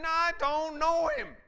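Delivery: high, loud, furious yell; screamed denial. Acted. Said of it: "Screaming curses".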